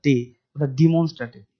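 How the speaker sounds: normal talking voice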